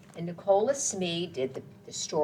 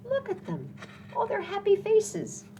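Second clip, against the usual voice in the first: ordinary delivery, nothing marked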